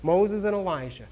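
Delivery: ordinary talk, nothing marked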